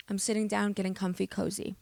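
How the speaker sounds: ordinary delivery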